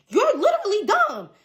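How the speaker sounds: high, loud scolding jab; mad outburst. Retold or imitated. Telling the friend off after the weak apology, mad. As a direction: throw the insult up high and loud. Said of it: "I was so fucking mad after that".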